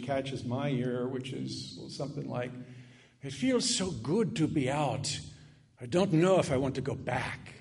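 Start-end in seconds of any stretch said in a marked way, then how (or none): 3.2-7.5 a hint of an Irish possessed man; Irish brogue. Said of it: "out comes the Irishman".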